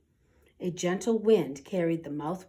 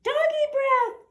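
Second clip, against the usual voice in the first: high, loud squeaky panic; screaming mice; 6 dB louder than usual. Acted. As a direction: shriek it like terrified little mice. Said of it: "screamed the soldiers".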